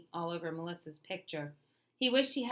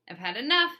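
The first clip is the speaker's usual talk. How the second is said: high, loud exasperated cry; 6 semitones higher, 10 dB louder than usual. A narrator's reading. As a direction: throw up your hands at the bully.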